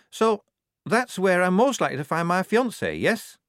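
high-pitched, hopeful recruit; timid young recruit. Acted. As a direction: seek reassurance in a high voice.

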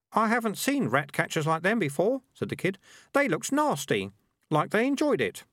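put-on high young boy voice; kid voice. A narrator's reading.

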